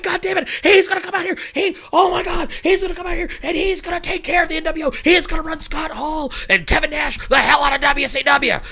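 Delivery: high-pitched, breathless run of hype; excited fan. Acted. Imitating fans as Hogan walks out to save WCW, mock excitement. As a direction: rave like a crowd sure its hero has arrived. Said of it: "and everybody's like".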